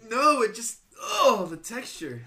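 high loud grossed-out groan; disgusted groan. Real, not acted. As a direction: gag at the texture. Gagging at the texture.

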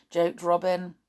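ordinary delivery, nothing marked

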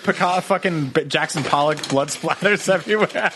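usual speaking voice

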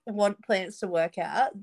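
ordinary delivery

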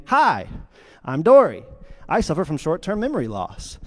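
perky cartoon introduction, as if meeting for the first time; Dory. Dory bumping into Marlin, perky. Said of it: "bumps into Marlin again".

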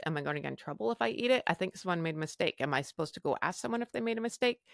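quick, hushed run of worried questions; anxious inner voices. Retold or imitated. Patient second-guessing herself over the dessert, anxious inner chatter. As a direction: rattle off the doubts racing in your head. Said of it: "got all these voices going".

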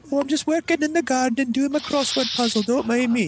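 very high, sing-song mock mom. Mom busy in the garden and crossword, high innocent voice. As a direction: put on a harmless, high-pitched mom. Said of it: "my mom is like".

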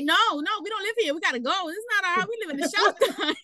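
imitating an insistent little boy, high-pitched; mock childish objection, high and urgent; child's protest. Mom imitates son correcting his godmother, high insistent protest.

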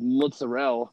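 mozzarella in Italian American style, final a dropped; Italian American pronunciation. Retold or imitated. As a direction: imitate Italian American "mozzarella", ending on a stressed "rell". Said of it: "like the Goodfellas version of saying it".

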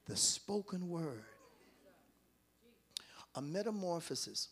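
low, near-whispered sermon; hushed preaching. Real, not acted. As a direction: draw them in, barely audible.

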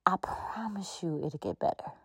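whispered promise. Real, not acted. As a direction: whisper it like a secret vow. Promise to listener.

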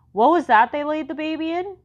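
put-on high, loud puzzled kid voice; voiced kid. Leroy asks, voiced child.

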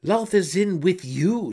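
loud, high, slow shout. A narrator's reading. Sister shouting at Brisa, loud. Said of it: "Shanane shouted at her".

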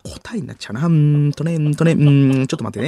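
long humming hesitations, sudden swells; Sato Jiro dithering. Acted. Actor struggling to give a riddle hint, hesitant humming impression. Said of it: "なぞなぞヒントを出そうとする佐藤二郎さん".

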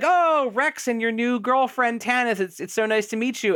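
high-pitched, imitated hostess welcome; gushing mock greeting. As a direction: coo over newly arrived guests in mockery. Hosts greet guests in retelling.

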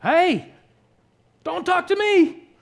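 furious high, loud outburst; angry Jacob. Acted. Husband lashing out at his demanding wife, angry shout. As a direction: snap back at full volume. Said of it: "Jacob's angry, rightfully angry".

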